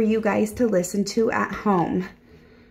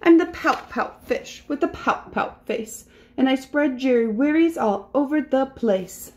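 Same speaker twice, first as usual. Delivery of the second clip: higher, rhythmic pouty refrain; glum pouting fish; 4 semitones higher than usual. Acted. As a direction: chant it like a sulky little fish. Voices the gloomy fish's refrain.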